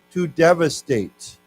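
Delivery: slow, clear model word. Shown as a demonstration. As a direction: say it slowly so they copy. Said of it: "why don't we say this".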